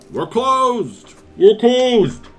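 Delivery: distant muffled yelling behind door; muffled shout. Acted. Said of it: "a distant, muffled voice".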